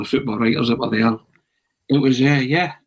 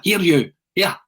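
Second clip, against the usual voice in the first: higher-pitched retold call; calling after someone; 7 semitones higher than usual. Manager calls after a man down the corridor, retold higher call. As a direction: call out to catch someone's attention.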